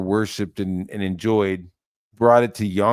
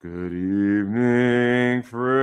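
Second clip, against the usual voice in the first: mock greeting; 2 semitones higher, much slower than usual.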